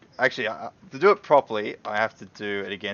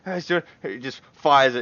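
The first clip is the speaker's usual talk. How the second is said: ordinary delivery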